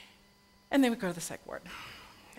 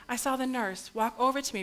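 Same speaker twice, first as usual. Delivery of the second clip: ordinary delivery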